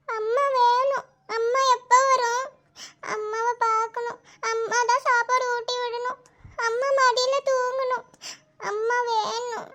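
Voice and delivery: put-on weepy, drawn-out child's whine; tearful pleading. Sick child pining for her mother, tearful and pleading.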